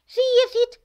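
ordinary delivery

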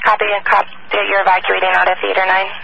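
ordinary talk

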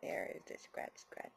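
soft unvoiced whisper. Real, not acted. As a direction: whisper while scratching. Whispering while scratching.

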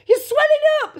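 high, loud cry of horror; scream. A narrator's reading. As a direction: scream like a panicking mother. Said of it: "screamed Missus Beauregard again".

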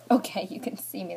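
ordinary delivery

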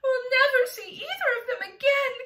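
high, loud wail; distressed cry. A narrator's reading. Ladybug fears both friends are lost, crying out. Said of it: "cried the ladybug".

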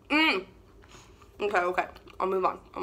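talking while chewing; muffled through food; mouth full. Real, not acted. Seen 1: Bites fry at -1, closed-mouth chewing through labelled words.

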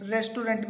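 "रेस्टोरेंट" as res-tu-rent; mispronunciation. Shown as a demonstration. Teacher teasing learners, wrong rent ending. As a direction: sound the final nt of "रेस्टोरेंट". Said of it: "में नहीं मेरे भाई".

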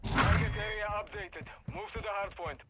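clipped game radio callout; distant military radio voice; radio announcer. Acted.

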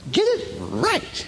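high, drawn-out mock repeat; mocking echo. Preacher ridiculing her favourite line, mocking echo. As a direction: stretch it out high, ridiculing the phrase.

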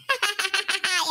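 low plotting chuckle; scheming laugh. Acted. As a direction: chuckle over a sneaky plan. Trama contro Luigi di nascosto.

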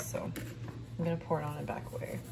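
usual speaking voice

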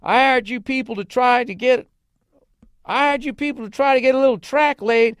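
high, loud bellow of a cowboy boss; Slim Pickens holler. Retold or imitated. Boss yells at singing railroad workers, loud hammed-up holler. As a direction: holler it at lazy workers who are goofing off. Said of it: "and says".

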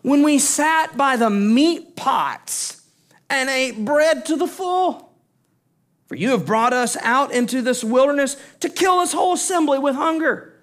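preacher acting out the hungry people accusing Moses; Israelites' complaint. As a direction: throw the crowd's blame at Moses. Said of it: "Listen to this".

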